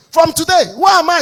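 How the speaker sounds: loud, high rising challenge. Acted. Preacher challenging the congregation, loud high question. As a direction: demand an answer from the crowd.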